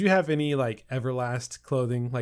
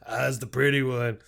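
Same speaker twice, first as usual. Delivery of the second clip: stripper impression; 3 dB louder than usual. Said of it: "The only person I can really mimic".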